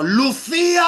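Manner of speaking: high shouted name from a car window; loud high calling shout; mother's shout. Retold or imitated. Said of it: "sacó medio cuerpo por ella y gritó".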